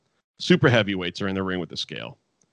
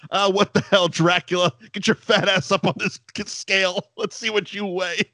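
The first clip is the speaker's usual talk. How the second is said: mock Bob Holly; 10 semitones higher than usual. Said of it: "looks and goes".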